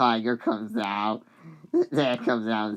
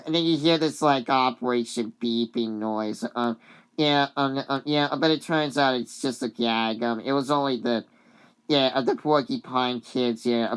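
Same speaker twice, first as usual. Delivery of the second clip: usual speaking voice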